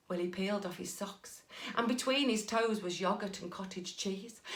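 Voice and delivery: ordinary delivery, nothing marked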